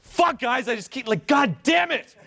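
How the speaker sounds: high-pitched venting of frustration; fed-up cursing at yourself; frustrated outburst. Shown as a demonstration. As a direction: demonstrate exasperation, pitch jumping up. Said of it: "If you're frustrated, you should be like".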